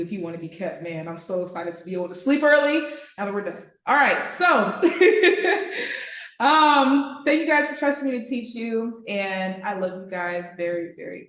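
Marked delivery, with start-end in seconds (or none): 4.9-6.4 bright rising laugh. Real, not acted. Thanking her trading class, a bright laugh.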